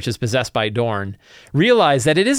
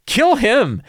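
high, loud mock cheer, drawn out at the end; excited players; 10 semitones higher, 4 dB louder, slower than usual. Players cheering the pit fiend on, high and excited. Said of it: "And they're like".

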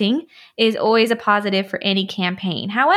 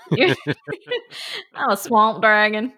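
very slow, drawn-out monster voice; swamp dragon voice; much slower than usual. Pretend. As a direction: stretch it out like a lumbering swamp beast. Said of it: "talking about the swamp dragon".

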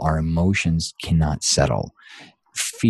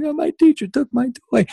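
imitating crying son; 4 dB louder than usual. Son crying over lost toy.

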